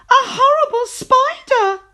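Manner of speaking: high, loud horrified shriek; terrified storybook shriek; scream. A narrator's reading. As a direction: scream at the spider. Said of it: "she screamed".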